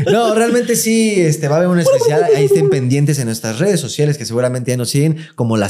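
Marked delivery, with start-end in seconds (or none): 0.1-1.1 laughing. Real, not acted. Habla riéndose tras carcajada.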